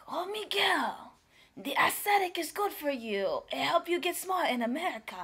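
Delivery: high, loud imitation of an eager friend; friend's coaxing voice. Friend Paul talks Miguel into trying it, coaxing. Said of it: "Paul was like".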